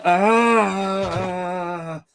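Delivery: short high yelp; a hammed-up cry of alarm; mock scream. Mock scream after theme.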